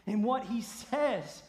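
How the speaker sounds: loud, high, emphatic declaration; impassioned preaching. Real, not acted. Preacher defending the straight way of the gospel, impassioned. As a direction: drive each phrase home from the pulpit.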